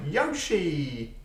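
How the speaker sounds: high, drawn-out Yoshi cry; Yoshi catchphrase. Retold or imitated. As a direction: imitate a cartoon creature calling its own name. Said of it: "he just went".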